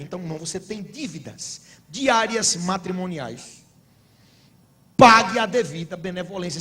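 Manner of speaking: normal talking voice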